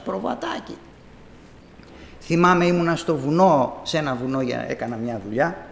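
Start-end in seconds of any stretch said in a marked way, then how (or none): 0.0-0.8 soft call. Acted. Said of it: "φώναζε ο Πιμένας".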